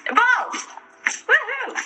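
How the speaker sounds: excited cheer. Real, not acted. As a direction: burst out with joy at the find. Excited unboxing of a bag.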